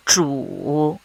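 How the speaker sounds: Zhu dipping low, then rising; third tone. Shown as a demonstration. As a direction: drop Zhu low and lift it. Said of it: "this is how we mark the tones".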